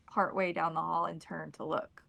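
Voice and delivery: usual speaking voice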